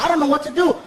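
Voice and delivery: normal talking voice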